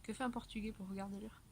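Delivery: normal talking voice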